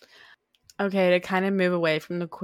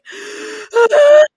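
high, loud shocked gasp; family gasping at dinner; imitated loud gasp; 14 dB louder than usual. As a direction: suck in air in mock horror. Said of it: "this family's like".

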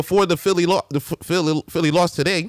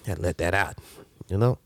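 much softer, still winded; speaking while recovering breath; 11 semitones lower, 6 dB quieter than usual. Real, not acted. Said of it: "I'm a little winded".

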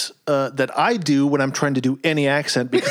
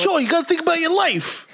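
loose New York-Italian accent, much higher pitch; Mister Turner accent; 12 semitones higher than usual. Shown as a demonstration. Teacher lectures student about his life, mock New York-Italian accent. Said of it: "I will do a perfect Mister Turner accent".

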